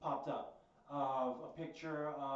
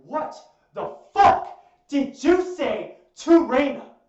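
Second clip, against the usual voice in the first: loud, slow, high-pitched furious outburst; retold blow-up at full force; snapping in anger; 10 semitones higher, 20 dB louder, slower than usual. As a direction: shout it word by word, enraged. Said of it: "I fucking snap on her".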